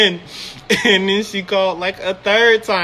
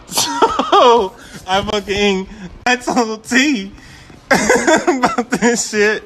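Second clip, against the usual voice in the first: laughing; 4 semitones higher, much slower than usual. Real, not acted. Laughing while telling.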